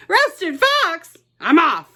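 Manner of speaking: a fox startled into fleeing; alarmed fox. Acted. Fox to the mouse, a high alarmed yelp.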